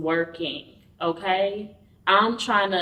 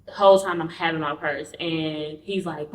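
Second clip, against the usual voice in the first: ordinary talk, nothing marked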